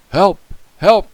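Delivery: loud, high emergency cry; urgent call. A narrator's reading. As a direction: call out for help over the radio. Policeman calls on radio.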